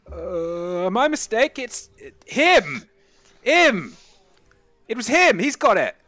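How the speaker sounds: high, loud confused blurting; dazed rewired gentleman. Acted. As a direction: stammer like your brain just rewired. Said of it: "and he just goes".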